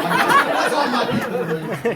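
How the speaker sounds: laughing exclaim. Real, not acted.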